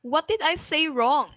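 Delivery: high on "say", dropping on "wrong"; rising-falling question. Shown as a demonstration. Teacher models an open question, rising then falling. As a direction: lift through "say" and fall on "wrong". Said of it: "rising, falling intonation".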